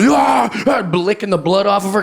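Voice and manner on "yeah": higher mock triumphant shout; hammed-up triumph; victory yell. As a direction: yell like a winner over her opponent. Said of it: "this Fallon's over going".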